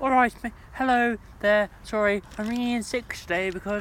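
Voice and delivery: suddenly bright and chummy on the phone; mock cheery British phone voice. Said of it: "your British phone voice kicks in".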